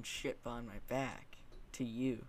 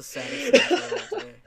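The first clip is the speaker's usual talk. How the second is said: high, loud cracking up; laughing; 13 semitones higher, 14 dB louder than usual. Real, not acted. Mocking friend's waifu confession, loud laughing. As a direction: burst out laughing through the words.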